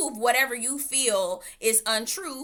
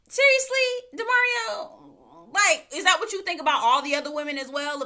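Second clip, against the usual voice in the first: outraged disbelief; 8 semitones higher, 6 dB louder than usual. Real, not acted. Outraged at DeMario's judgement.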